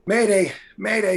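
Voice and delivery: mock distress call. Calling co-host over lost audio, mock distress call. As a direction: call out a playful radio alarm.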